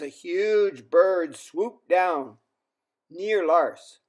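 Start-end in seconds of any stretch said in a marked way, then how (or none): none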